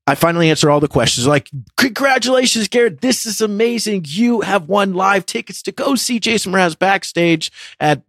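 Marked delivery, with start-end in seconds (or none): 1.8-8.1 high-pitched mock prize announcement; excited radio host. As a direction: gush the big win like a radio presenter. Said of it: "they're getting excited".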